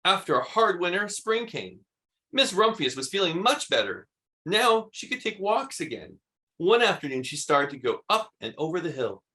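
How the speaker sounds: plain reading voice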